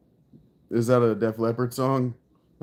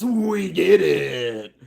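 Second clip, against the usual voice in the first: slow, higher DJ aside; radio DJ voice; 4 semitones higher, much slower than usual. Acted. Streamer finishing his DJ read-in. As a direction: drawl the aside like a DJ. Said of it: "did the read-in".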